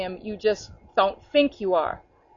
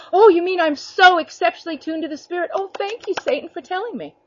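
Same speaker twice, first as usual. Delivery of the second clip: fake-delighted thanks to Satan, rising high; mock grateful; 8 semitones higher than usual. Preacher mocks Satan's lies with sarcastic high gushing thanks. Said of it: "you're never gonna hear the Spirit say".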